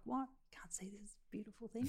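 whispered, hurt disbelief; hushed as if wounded by the insult; mock whisper. Whispering back at the manufacturer, hurt. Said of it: "I was like".

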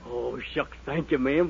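usual speaking voice

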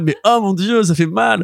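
high, half-laughing groan of sympathy; pained exclamation. Retold or imitated. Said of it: "T'es en mode".

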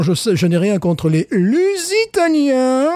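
slow, high mock sports commentator; football commentator voice. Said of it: "comme disent les commentateurs de foot".

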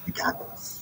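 whispered echo; soft whispered repeat. Pretend. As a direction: breathe it out, no voice.

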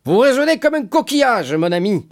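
high, loud tipsy scoff; mocking drunkard. A narrator's reading. Caderousse scoffing at Fernand, drunken mockery. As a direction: heckle like a drunk at table.

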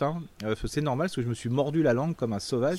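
normal talking voice

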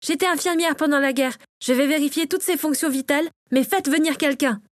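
Natacha, urgent. A narrator's reading. Natacha takes over care and sends for help, urgent.

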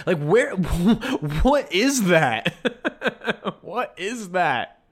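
slow, laughing through the words; real laugh of disbelief. Real, not acted. Laughing at the four Earths claim.

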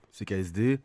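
usual speaking voice

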